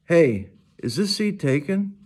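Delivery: put-on bright friendly question from a new girl; girl's voice. A narrator's reading. Girl greets August at lunch.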